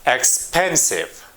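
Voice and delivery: "expensive" slowly, stressed on PEN; "expensive" as ex-PEN-sive, a slow word demo; stress on middle syllable. Shown as a demonstration. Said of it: "In the middle".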